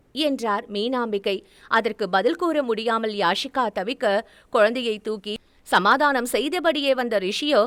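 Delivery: usual speaking voice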